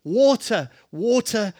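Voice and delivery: high, loud cry for water; loud, desperate plea for water; desperate shout. Retold or imitated. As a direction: shout it like a parched man. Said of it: "he would push him aside and shout".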